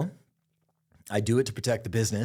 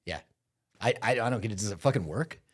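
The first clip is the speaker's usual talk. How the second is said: ordinary delivery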